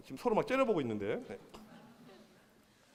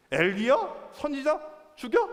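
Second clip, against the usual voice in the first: acted queen, each question pitched higher; imperious, murderous queen; 6 semitones higher, slower than usual. Queen dismissing God's prophet, acted charismatic menace. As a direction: fire off dismissive questions, rising to a threat. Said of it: "아주 카리스마".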